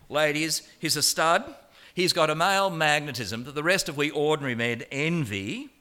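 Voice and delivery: ordinary delivery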